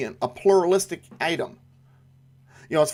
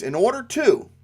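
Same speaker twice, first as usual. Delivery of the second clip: "in order to" lifted high; stress on in order to; 7 semitones higher, 3 dB louder than usual. Shown as a demonstration. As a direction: raise "in order to" as a signpost. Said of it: "in order to tells us we have four reasons coming".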